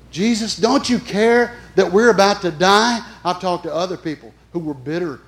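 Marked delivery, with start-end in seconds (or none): 0.1-3.0 loud, high pleading cry; lightly voiced panicked disciple crying to Jesus; desperate plea. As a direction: beg for rescue in alarm. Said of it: "voicing the frightened disciples".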